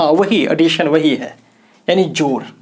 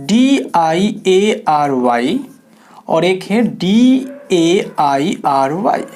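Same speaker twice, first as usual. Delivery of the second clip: spelled out slowly; 2 semitones lower, much slower than usual. Shown as a demonstration. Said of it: "spelling out letters".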